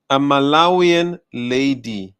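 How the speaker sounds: "A Malawian lady" slow and loud; incredulous emphasis. Real, not acted. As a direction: stretch out "Malawian lady" loudly, in disbelief. Stressing who dared say it.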